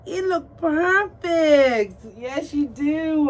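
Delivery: high, loud, slow sing-song praise. Real, not acted. Doll collector gushing at her new baby, slow high coo.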